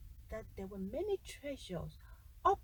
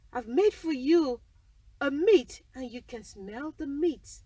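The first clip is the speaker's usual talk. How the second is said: the cook loudly brushing the giant off; loud, dismissive kitchen cook; 11 dB louder than usual. Acted.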